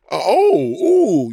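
loud high gasp of shock; gasping surprise. Retold or imitated. Acting the reaction she wanted on the runway, loud gasp. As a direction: gasp like the reveal floored you. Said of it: "you know".

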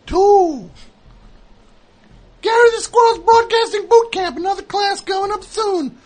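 radio host sign-off. Acted. Show sign-off.

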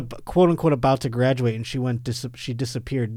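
ordinary talk

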